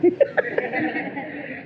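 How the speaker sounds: high unvoiced chuckle; airy laugh. Real, not acted. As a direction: laugh softly without voice. Laughs at own joke. (tawa lirih)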